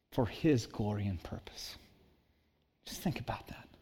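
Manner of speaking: hushed whisper; breathy hushed preacher's whisper. Real, not acted. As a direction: drop to a reverent whisper.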